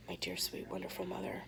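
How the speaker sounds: ordinary delivery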